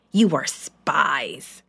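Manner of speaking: low, slow accusing ruler; stern Joseph. A narrator's reading. Joseph accusing his brothers, low and slow. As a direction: slowly lay the charge on them. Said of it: "he said".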